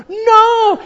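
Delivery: retold crowd shout. Teammates screaming at the runner heading the wrong way, loud yell. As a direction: yell like a crowd seeing disaster. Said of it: "están diciendo".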